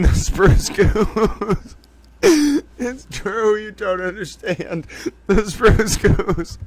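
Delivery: helpless, drawn-out words through laughter; laughing to tears. Real, not acted. Host explains the airplane to viewers, helpless with laughter. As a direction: plead while cracking up. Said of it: "I'm crying. Te-, towel tears of laughter".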